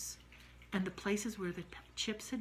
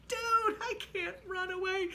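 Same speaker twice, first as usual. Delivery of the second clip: higher, plaintive protest; high whiny protest; 12 semitones higher, 5 dB louder than usual. Retold or imitated. Narrator calling after Jenny, high plaintive whine. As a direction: whine it out to your running friend.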